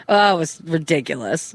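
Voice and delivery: usual speaking voice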